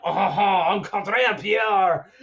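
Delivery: badly faked French accent; jokey, hammed-up French accent with a little laugh; mock French accent. Podcaster doing his self-declared worst voice, mock French accent. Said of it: "Frenchy kind of stuff".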